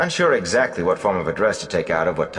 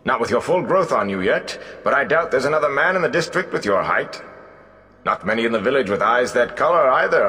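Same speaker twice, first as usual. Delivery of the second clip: flattering bard; 5 semitones higher than usual. Acted. Gleeman flatters Egwene to be his assistant, charming.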